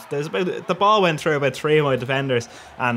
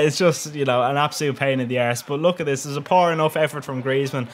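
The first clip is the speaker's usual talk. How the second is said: ordinary delivery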